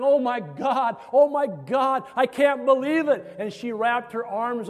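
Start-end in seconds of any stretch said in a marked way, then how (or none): 0.0-3.2 loud, high mock exclamations of disbelief; retold screaming and crying. Woman greeting the man outside her house, imitated overjoyed cry. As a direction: exclaim it, raised in pitch and volume. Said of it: "she's screaming, and she's crying".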